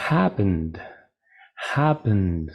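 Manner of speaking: "Happened" with a humming N into D, falling; voiced D ending. Shown as a demonstration. Teacher modelling the next verb, voiced ND ending. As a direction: land "Happened" on a voiced "nd", no extra syllable. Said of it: "Si termina con vibración".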